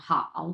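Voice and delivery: "হাও" dipped low, in Mandarin third tone. Shown as a demonstration. Teacher repeating the word for learners, low dipping "হাও". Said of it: "তিন number tone".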